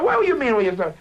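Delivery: high, loud acted challenge; belligerent. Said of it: "I'm belligerent to this guy".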